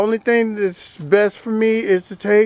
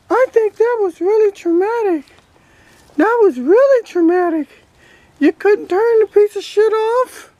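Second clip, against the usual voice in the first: higher, cheeky toy duck voice; duck puppet voice; 9 semitones higher than usual. Acted. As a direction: voice the toy duck answering back. Voicing toy Ducky's replies.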